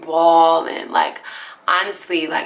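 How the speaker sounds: plain reading voice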